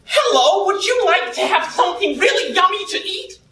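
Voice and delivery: high-pitched American server voice; bright-pitched, eager American sales pitch; put-on American accent. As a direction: offer food in a high, hammed-up American accent. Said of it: "Did you like my American accent?".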